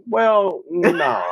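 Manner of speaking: high protesting well. Real, not acted. Playful protest to wife.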